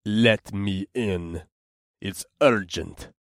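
slow gruff orc voice. A narrator's reading. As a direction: growl it slowly.

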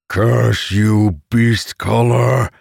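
slow, loud booming monster voice; monster bellowing. Acted. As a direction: boom it out like a giant beast. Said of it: "the monster bellowed".

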